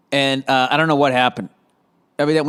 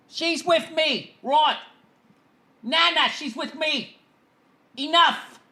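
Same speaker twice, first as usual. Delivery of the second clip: put-on high, soft, drawn-out English accent; slow, quieter mock English voice, pitched high; 10 semitones higher, 5 dB quieter, slower than usual. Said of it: "hearing that stupid accent".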